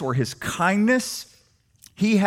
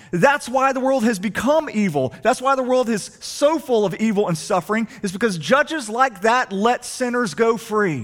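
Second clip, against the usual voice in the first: raised, pressing sermon voice; impassioned preaching; 7 semitones higher than usual. Acted. Pastor voicing the accuser's case, raised and urgent. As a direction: press the accusation, rising in pitch.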